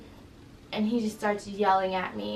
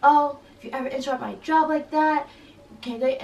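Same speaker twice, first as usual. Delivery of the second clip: higher, louder mock threat; retold threat; 6 semitones higher, 7 dB louder than usual. Student imitating the man who threatened her, raised threatening voice. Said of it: "was, like, threatening me".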